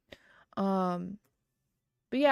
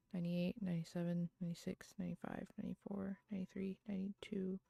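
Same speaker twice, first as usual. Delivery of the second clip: soft, fast counting that fades to a whisper; tally the buttons quietly to yourself, speeding up; counting under breath; 14 dB quieter, faster than usual. Real, not acted. Counting leftover buttons to herself, fading into a whisper.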